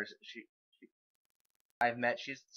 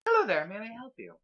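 high-pitched, well-mannered lady; formal, high character voice; 9 semitones higher, 4 dB louder than usual. Acted. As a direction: greet them with refined politeness. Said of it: "high-mannered".

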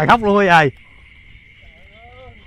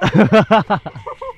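laugh; 2 semitones higher, 3 dB louder than usual. Real, not acted. Laughing while watching the old man get hit, amused laugh. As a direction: burst of laughter.

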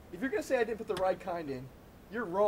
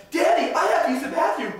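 put-on whiny kid shout; 6 semitones higher, 6 dB louder than usual. Kids shouting from backseat.

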